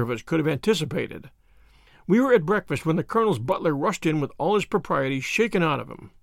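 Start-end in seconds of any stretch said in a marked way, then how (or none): none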